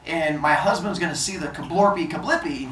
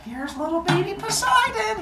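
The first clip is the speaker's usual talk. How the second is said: put-on high, cooing baby introduction; high-pitched gushing mom voice; proud new mother. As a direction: present a newborn in a high singsong. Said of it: "she walks in and she's like".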